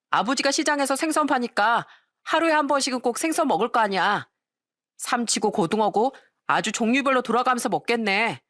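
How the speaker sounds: put-on high, jeering boyish taunt; mocking school bullies. A narrator's reading. Classmates picking on fishmonger's son at lunchtime, mocking taunts. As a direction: needle the victim with exaggerated teasing. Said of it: "짓궂은 친구들이 꼭 한두 명씩".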